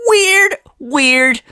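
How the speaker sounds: high, sneering repeat; high mocking sing-song. Real, not acted. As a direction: sneer the word back. Mocking repetition.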